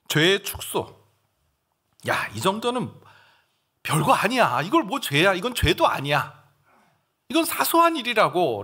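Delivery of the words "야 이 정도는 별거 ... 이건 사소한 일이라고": higher and louder, scoffing at sin; dismissive tempter. Acted. Preacher voicing Satan shrugging off small sins. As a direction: wave it away.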